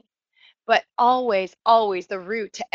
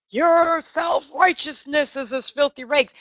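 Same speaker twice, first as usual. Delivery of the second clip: high, slow, drawn-out judgment voice; mock condemning God; 5 semitones higher than usual. Teacher parodies a condemning God to her class, hammed-up and high. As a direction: stretch it out as an exaggerated pronouncement of doom.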